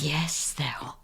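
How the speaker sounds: flat, breathy whisper; whispered reply. Retold or imitated. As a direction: whisper it back, deadpan. Her deadpan reply to customer.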